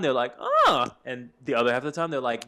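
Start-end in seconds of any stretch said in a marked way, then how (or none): 0.4-0.9 high, loud "ah" of reaction; imitated impressed listener; mock approval. Voicing people hearing the accents, mock approval.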